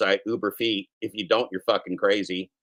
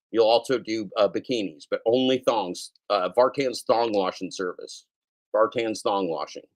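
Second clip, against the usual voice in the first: ordinary talk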